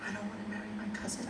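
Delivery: fast, soft little squeak; a soft, timid squeak instead of a yell. Retold or imitated. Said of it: "but more like a squeak".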